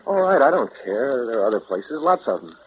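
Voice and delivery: loud, insistent outburst; urgent insistence. Acted. Urging Jim to get away somewhere, loud impatient insistence.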